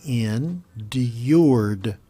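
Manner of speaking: ENDURED slowly pulled apart, in... d-yourd. Shown as a demonstration. Teacher slowing a word down for English learners, said slowly. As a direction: stretch "endured" out syllable by syllable. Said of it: "If you say it slowly".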